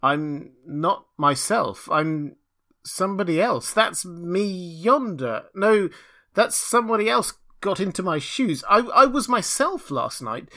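high, bewildered outcry, halting over repeated words; at his wits' end. A narrator's reading. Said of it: "exclaimed he at his wits' end".

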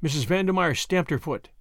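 plain reading voice

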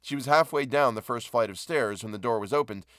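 usual speaking voice

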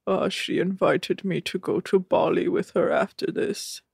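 put-on accent, soft measured monotone; Rob's accent. Host imitating Rob talking about his future, put-on accent. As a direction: do Rob's accent, deadpan and deliberate. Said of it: "I just wanted to do the accent".

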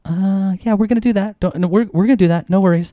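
high, hurried reassurance; nervous suitor. Retold or imitated. Suitor eagerly reassuring his girlfriend's dad, nervous. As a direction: pitch up and placate him quickly.

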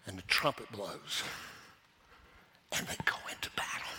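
whispered dramatic aftermath; hushed, quick storytelling. Real, not acted. Hushed drop after the shout.

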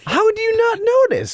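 incredulous. Real, not acted. Host incredulous at driver's oversight.

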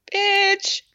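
high-pitched, hammed-up comeback; sassy put-on exclamation; mock sassy retort. Voicing Gail's sassy retort to Mitch.

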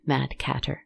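ordinary delivery, nothing marked

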